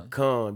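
Pecan stressed on KAHN; Pecan as puh-KAHN. Shown as a demonstration. Host showing how it's pronounced, Pecan as puh-KAHN. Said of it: "that's how it's pronounced".

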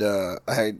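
ordinary delivery

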